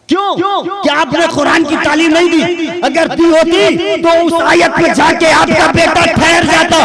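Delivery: high, loud, impassioned sermon cry; fiery preaching. Acted. Preacher exhorting the congregation, impassioned loud sermon. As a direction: preach it at full force to the hall.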